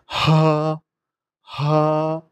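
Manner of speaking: হা pressed from the throat; হা, চেপে; Arabic ha squeezed. Shown as a demonstration. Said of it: "হা চিপে বলতে হবে".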